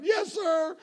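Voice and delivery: high, loud whimpered answer; mock tearful child. Daughter admitting wrong to dad, mock tearful. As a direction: answer like a crying little girl.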